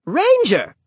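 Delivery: high, loud, sharp call. A narrator's reading. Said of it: "The woman spoke sharply too".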